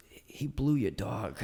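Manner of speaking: whispered, slow punchline; hushed punchline. Retold or imitated. Escort whispers the dog news to Johnny, hushed and slow. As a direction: lean in and breathe the line out. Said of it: "And she goes".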